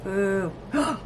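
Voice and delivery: ordinary talk, nothing marked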